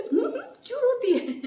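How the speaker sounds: high mock teasing voice; mocking others. Imitating people who ask why she cries, high teasing. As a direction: tease her like the others do, pitched high. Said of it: "सबको बोले".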